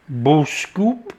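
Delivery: Boskoop with a long oo, rising at the end; Boskoop, the Dutch-style oo. Shown as a demonstration. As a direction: say Boskoop as BOOS-koop. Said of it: "however way you pronounce it".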